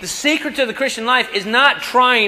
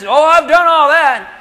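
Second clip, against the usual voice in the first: higher, louder smug claim; mock proud ruler; 8 semitones higher, 8 dB louder than usual. Rich young ruler boasting to Jesus, loud smug claim. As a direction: toss it off like a proud young man.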